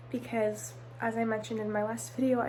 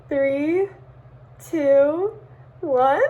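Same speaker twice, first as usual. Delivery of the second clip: louder and louder countdown; excited countdown; 4 semitones higher, 12 dB louder, much slower than usual. Real, not acted. Counting down to submit report.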